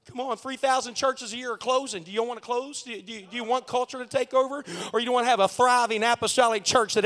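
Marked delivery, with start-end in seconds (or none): none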